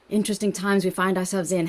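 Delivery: normal talking voice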